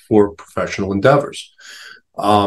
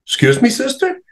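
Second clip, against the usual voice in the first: much higher, startled question; shocked student. Retold or imitated. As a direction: react as if you can't believe a nun swore. Student shocked by nun's swearing.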